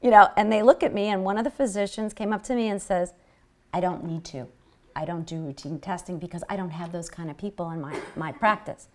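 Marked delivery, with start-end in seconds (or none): 3.7-8.9 retold hush, low and much softer; imitating a whisperer. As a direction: drop to a confidential murmur, quoting the physician. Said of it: "And he's whispering".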